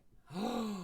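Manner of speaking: high, sharp intake of breath; surprised gasp. Real, not acted. Streamer spots familiar coil pictures, surprised gasp. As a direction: gasp in recognition.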